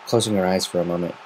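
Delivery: ordinary delivery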